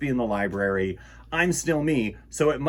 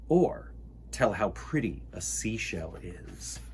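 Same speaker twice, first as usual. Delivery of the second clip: hushed whisper of wonder; 4 semitones lower, 5 dB quieter than usual. Acted. Reading to children about a seashell, whispered. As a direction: breathe it like a secret.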